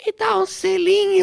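Hebe Camargo impression. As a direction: coo it like Hebe. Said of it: "Hebe Camargo".

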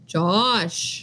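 long drawn-out name as greeting; dad answering phone. Retold or imitated. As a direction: mimic a dad picking up. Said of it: "always answers the phone".

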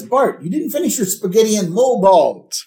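high, loud mock complaint; Homer. Homer to Bart over unfinished spaghetti, high loud complaint. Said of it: "And Homer".

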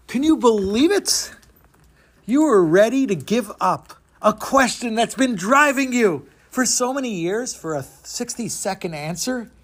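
ordinary delivery

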